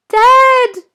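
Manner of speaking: very high, loud desperate call; calling loudly. Acted. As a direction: shout for Dad across the cave. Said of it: "he calls loudly".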